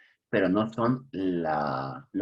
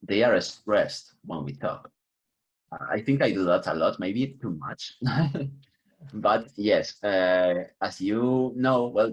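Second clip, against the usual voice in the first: usual speaking voice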